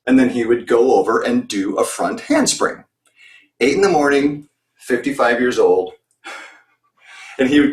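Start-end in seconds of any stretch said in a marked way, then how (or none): none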